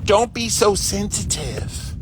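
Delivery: dismissive quote. Retold or imitated. Quoting a dismissive phrase.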